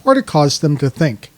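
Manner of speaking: polished, projected introduction of a guest; podcast intro read. Acted. Host introducing the guest to listeners, scripted announcer read.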